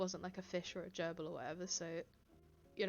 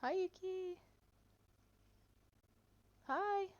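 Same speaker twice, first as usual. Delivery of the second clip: high cooing pet voice; 11 semitones higher, slower than usual. Real, not acted. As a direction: coo hello up high to the cat. Greeting her cat sweetly.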